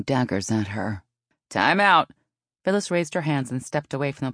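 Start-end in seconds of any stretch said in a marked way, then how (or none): 1.5-2.1 loud time-out shout; umpire's call. A narrator's reading. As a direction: call a halt to play.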